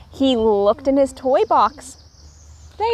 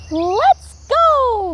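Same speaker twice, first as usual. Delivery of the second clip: eager call; 12 semitones higher, 4 dB louder than usual. A narrator's reading. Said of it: "Little Raccoon said".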